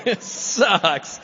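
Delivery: normal talking voice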